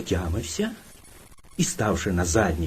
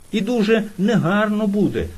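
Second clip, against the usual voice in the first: ordinary delivery, nothing marked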